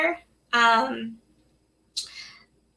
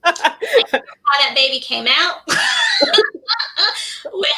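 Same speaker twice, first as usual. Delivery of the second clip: laughing; 6 semitones higher, 5 dB louder, slower than usual. Real, not acted. Laughing while telling birth story.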